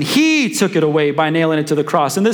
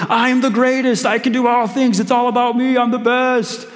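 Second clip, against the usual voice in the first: a hint of a boastful kid, higher; mock brag, lightly voiced; bragging; 6 semitones higher than usual. Smalls gloating after the catch, mild mock boast. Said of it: "been like".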